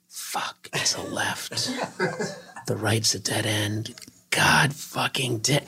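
hushed panicked muttering to himself; whispered swearing. Retold or imitated. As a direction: whisper the curses, slowing at the end. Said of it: "I went".

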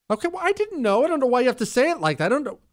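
high-pitched, flustered defensiveness; defensive protest. Real, not acted. Host defending his Halloween question to Chris, defensive.

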